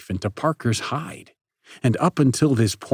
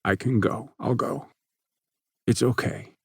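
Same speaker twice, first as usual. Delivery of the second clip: tense voice; 2 semitones higher than usual. Acted. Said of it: "that wretched tension climbing in his voice".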